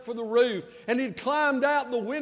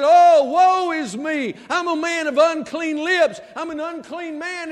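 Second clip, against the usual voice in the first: anguished cry; 5 semitones higher, 9 dB louder than usual. Retold or imitated. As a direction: cry it out in despair. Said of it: "He said".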